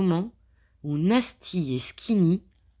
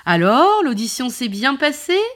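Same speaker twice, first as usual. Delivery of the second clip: cheerful; 6 semitones higher, 6 dB louder than usual. Acted. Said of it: "demande joyeusement le trio".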